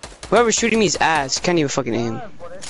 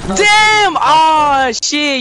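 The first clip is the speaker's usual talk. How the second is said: sudden high outburst, much louder; shocked shout; 5 semitones higher, 5 dB louder, much slower than usual. Real, not acted. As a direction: yell out at the hit. Gamer reacts to sudden hit.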